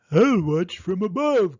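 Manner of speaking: buzzard calling. A narrator's reading. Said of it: "called Buzzard high in the sky".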